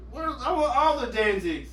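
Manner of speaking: high, whiny-indignant protest; mock outrage of a cheated fan; indignant complaint. Retelling old outrage at album cover.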